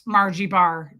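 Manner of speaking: ordinary talk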